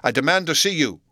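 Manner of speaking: forceful, authoritative call; loud demand. A narrator's reading. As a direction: demand it loudly through the door. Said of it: "cried Utterson with a loud voice".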